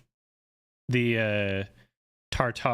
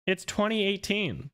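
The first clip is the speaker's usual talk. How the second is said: pitched much higher, exasperated; indignant correction; 9 semitones higher than usual. Real, not acted. Correcting a chatter about a film's year, exasperated. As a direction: set the chatter straight, fed up.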